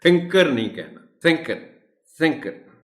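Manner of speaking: "थिंकर" high and quick; pronunciation model. Shown as a demonstration. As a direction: model "थिंकर" without heavy थ. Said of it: "उसको avoid करना".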